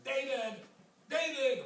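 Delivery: loud high call up the stairs; calling upstairs. Retold or imitated. Wife calling her husband down the stairs, loud high call. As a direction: shout the name up to the floor above.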